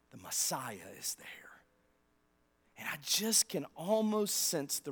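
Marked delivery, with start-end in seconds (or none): 0.1-1.6 slow reverent whisper; awed whisper. Acted. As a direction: hush it out in wonder. Sermon climax, awed reveal.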